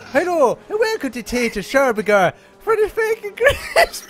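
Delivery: put-on very high, loud Irish accent breaking into laughter; hammed-up Irish, losing it to giggles. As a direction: squeal it in mock Irish, trying not to laugh. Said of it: "in your Irish accent".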